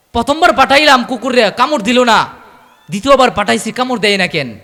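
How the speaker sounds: loud, high outburst; exasperated master. Acted. Master asking why his dog will not bite, loud exasperation.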